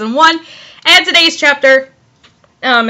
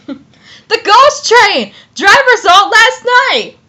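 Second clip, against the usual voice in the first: high, breathless-news exclamation; excited announcement; 9 semitones higher, slower than usual. Acted. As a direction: blurt out the ghost sighting. Acted character announces a ghost sighting.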